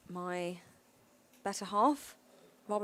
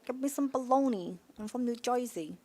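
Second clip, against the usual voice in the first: higher, hammed-up Jersey twang; New Jersey accent; 3 semitones higher, 4 dB louder than usual. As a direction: order like a cartoon New Jersey local. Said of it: "Can you do a New Jersey accent".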